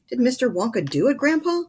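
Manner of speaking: put-on eager young boy pleading; high, eager Charlie asking his grandpa; eager child. A narrator's reading.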